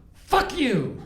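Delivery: a hammed-up insult; man on the street snapping at the passerby, mock; mock snarl. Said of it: "And he goes".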